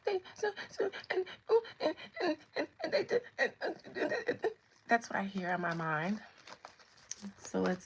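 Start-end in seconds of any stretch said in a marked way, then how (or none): 0.0-4.5 tiny high, broken whimper; mock whimpering. Reader voices what she hears in her mind, mock whimpering. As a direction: whimper in little stammered bursts, very high. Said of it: "I hear a little whimpering".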